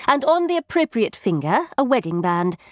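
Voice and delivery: ordinary delivery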